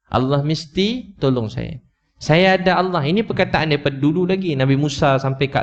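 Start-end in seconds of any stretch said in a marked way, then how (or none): 0.1-3.0 Allah mesti tolong saya drawn out slowly, rising at the end; slow earnest prayerful declaration. Shown as a demonstration. Preacher models the phrase for his congregation, slow and fervent. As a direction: say it slowly like a vow. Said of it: "Cakap perkataan tu".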